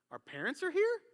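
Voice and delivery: put-on high, puzzled girlfriend question; imitated clueless surprise.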